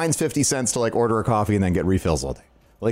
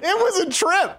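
laughing through the words; giggling. Real, not acted. Laughing about the homeless lady.

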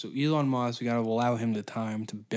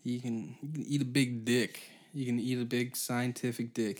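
ordinary talk

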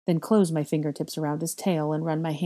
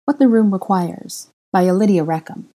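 show announcer; 2 semitones higher, 8 dB louder than usual. Acted. Reader presenting a fanfic to listeners, loud announcer style. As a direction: announce the title loudly and deliberately.

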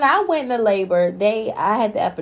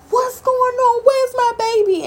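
high frantic plea; panicked; slower than usual. Retold or imitated. Mother panicking baby not crying.

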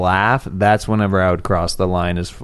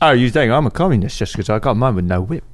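light Cockney film star voice; Michael Caine voice. Pretend. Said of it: "your Michael Caine".

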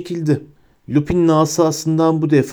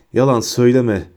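normal talking voice

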